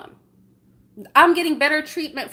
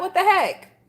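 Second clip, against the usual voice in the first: higher, incredulous exclamation; pitched-up what the heck; exasperated; 6 semitones higher than usual. Real, not acted. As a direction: throw it out in disbelief.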